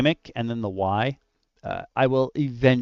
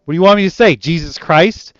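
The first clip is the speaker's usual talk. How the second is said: higher, louder exasperated movie quote; Star-Lord line; 7 semitones higher, 10 dB louder than usual. Retold or imitated. Player quoting the movie hero mid-interrogation, lightly exasperated. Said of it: "just like Star-Lord".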